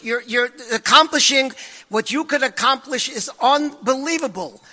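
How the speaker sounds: usual speaking voice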